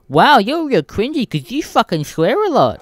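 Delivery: high, loud whiny mock commenter. Imitating a whiny commenter mocking his swearing. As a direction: sneer it in a nasal-free whiny parody.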